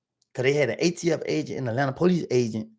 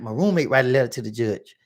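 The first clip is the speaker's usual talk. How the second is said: normal talking voice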